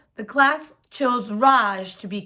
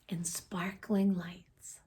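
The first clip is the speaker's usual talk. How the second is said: whispered wonder; 3 semitones lower, 11 dB quieter, slower than usual. A narrator's reading. Reading theater sign with wonder.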